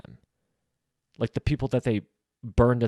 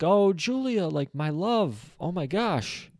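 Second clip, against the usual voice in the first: retold dream outcry, pitched up; mock crying out; 6 semitones higher, slower than usual. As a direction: imitate a lovestruck cry without raising the volume. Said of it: "he's screaming out".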